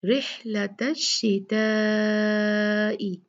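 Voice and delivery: शिताई drawn out long over four counts; very slow rih-lata shi-taa-i; four-count stretch. Shown as a demonstration. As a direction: hold the ता in शिताई for four beats. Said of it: "चार हरका खींचना है".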